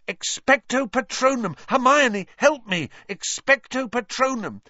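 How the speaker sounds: high, urgent cries; desperate shouting. A narrator's reading. Harry begging Hermione's help against Dementors, desperate shout. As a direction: call out high for help mid-spell.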